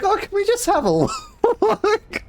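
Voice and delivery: very high pleading squeak, breaking into laughter. Retold or imitated. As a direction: beg like an eager kid, imitated. Begs friends to visit donut shop.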